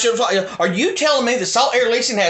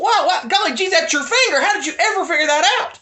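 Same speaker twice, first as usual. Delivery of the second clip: put-on wholesome 1950s kid; 7 semitones higher than usual. Sarcastic gee-whiz mock of doubters.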